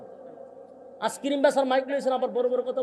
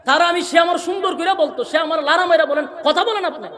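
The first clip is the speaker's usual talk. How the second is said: loud, high rebuke; angry scolding; 5 semitones higher, 8 dB louder than usual. Real, not acted. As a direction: scold him loudly. Preacher scolding the operator. (রাগী ধমক)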